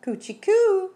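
very high, loud sing-out coo. A narrator's reading. Rita teases what is in the shoebox, cooing high. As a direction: coo as if tickling a baby, stretched high. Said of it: "said Rita".

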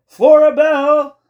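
very high, drawn-out shout; loud call. A narrator's reading. Boy calling to Flora at night, loud high holler. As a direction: holler the name, stretching it out.

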